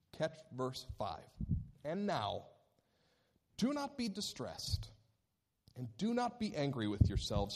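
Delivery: measured Bible reading to the congregation; scripture reading. Acted. Pastor reading Genesis to his congregation, measured. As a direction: read the verses with weight, letting the drama show. Reads scripture passage aloud.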